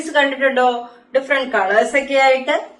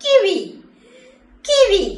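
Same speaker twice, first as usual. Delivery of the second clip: emphatic model word; clear teaching word; 7 semitones higher, much slower than usual. Shown as a demonstration. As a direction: say kiwi crisply and pitched up for kids. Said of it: "എന്താണ്?".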